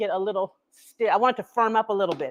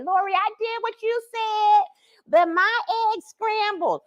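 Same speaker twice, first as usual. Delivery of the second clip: whiny viewer; 8 semitones higher, slower than usual. Retold or imitated. Imagined viewer complaint quoted.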